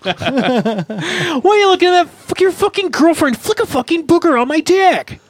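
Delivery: put-on high, indignant kid rant; hammed-up high-pitched scolding; mock outrage. Sixth-grader challenging a boy about his girlfriend, high mock outrage. Acted outburst at schoolmate.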